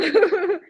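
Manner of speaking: high quick laugh. Real, not acted. Buyer laughing about texting her lender anytime. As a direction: laugh at the idea.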